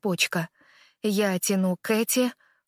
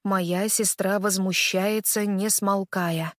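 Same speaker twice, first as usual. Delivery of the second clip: ordinary delivery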